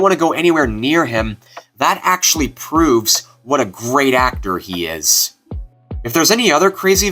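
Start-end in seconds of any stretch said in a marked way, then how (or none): none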